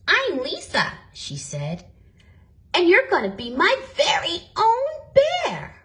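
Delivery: put-on girl promising a bear a home; happy little girl. A narrator's reading. Girl voiced happily.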